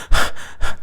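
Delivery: demonstrated breathless puffs; out-of-breath panting. Shown as a demonstration. Teacher showing students what out of breath means, heavy panting. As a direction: pant as if winded from running. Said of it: "You're doing this".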